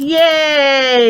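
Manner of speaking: bright cheering yay; teacher cheering the class on. Acted. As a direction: celebrate the count loudly. Cheering after counting.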